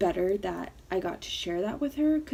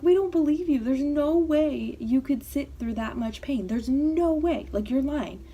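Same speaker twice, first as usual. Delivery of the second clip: imitating loud, high-pitched disbelief; mock outraged incredulity, louder and higher; disbelieving parents; 7 semitones higher, 6 dB louder than usual. Parents doubting daughter sat through a tattoo, incredulous.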